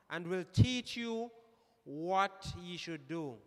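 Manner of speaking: slow, emphatic Bible recitation; scripture reading. Real, not acted. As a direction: read it out with deliberate weight on the key words. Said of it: "You read it so excellent".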